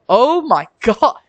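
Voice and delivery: loud, slow, stunned outburst; shocked laughing exclamation. Real, not acted. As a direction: blurt it out in disbelief. Reacting to a crude joke.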